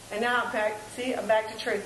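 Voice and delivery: ordinary talk, nothing marked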